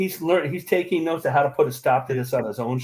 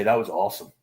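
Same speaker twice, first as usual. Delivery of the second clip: Duck impression; 5 semitones lower than usual. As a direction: lower put-on Duck voice. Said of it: "Or as Duck would say".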